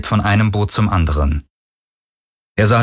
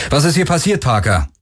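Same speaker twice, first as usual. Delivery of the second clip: high-pitched called question; raised, demanding call; 7 semitones higher than usual. A narrator's reading. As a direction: call the question across to him. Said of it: "rief Galen".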